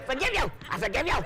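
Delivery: high, clipped, reluctant forgive you; hammed-up grudging forgiveness; grudging mock forgiveness. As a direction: forgive through your teeth, laughing. Mock forced forgiveness to herself.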